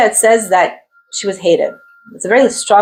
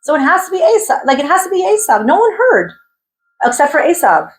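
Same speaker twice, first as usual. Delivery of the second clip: high, raised voice of Yitzhak; Yitzhak insisting; 8 semitones higher than usual. Retold or imitated. Yitzhak reassuring himself no one heard, pitched-up insistence. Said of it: "but he's like".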